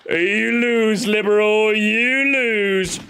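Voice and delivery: high taunting cackle, then sing-song "you lose"; mocking taunt. Streamer taunting the assistant over its Zelda pick, high and gloating. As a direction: gloat like a playground bully.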